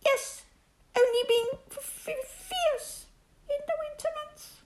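high, trembling, stuttering f- f- fierce; frightened little pig trailing off; stammering Piglet. Acted. Piglet nervously stammers to Rabbit, high and trembling.

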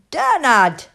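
put-on Bernard as a high, loud toddler's "Dernad"; toddler attempt. A narrator's reading. Annie Rose finally saying Bernard, high loud toddler voice. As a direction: pipe up Bernard like a baby, B turned to D. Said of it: "she couldn't talk properly yet".

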